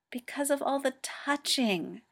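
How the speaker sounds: tired, deflated delivery; weary sigh. A narrator's reading. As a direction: sigh the words out wearily. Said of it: "Hikaru sighs wearily".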